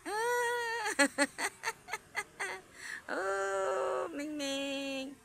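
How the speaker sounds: high, lilting call of the cat's name; sing-song name calling. Real, not acted. Calling the cat sweetly.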